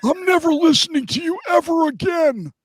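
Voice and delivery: high, squeaky laughing impression of a GM; hammed-up whiny exec voice, cracking with laughter; squealing mock general manager; mock Trent Baalke.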